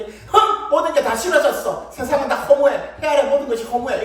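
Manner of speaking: loud, high huff of mock despair; melodramatic tantrum. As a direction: ham up a sulking child's outburst. Acted tantrum at the dinner table.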